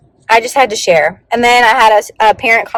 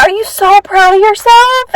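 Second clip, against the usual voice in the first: tender; 11 semitones higher than usual. Retold or imitated. Teacher to a crying girl after a hug, high tender coo. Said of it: "I said".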